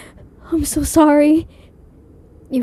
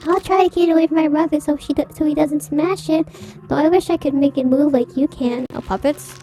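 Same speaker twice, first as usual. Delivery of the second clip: put-on high childish voice, much higher; baby voice; 4 semitones higher than usual. [name] forced by the ghost to talk like a child, high baby voice. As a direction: play a little kid, eager and sweet. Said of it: "she's been doing a baby voice".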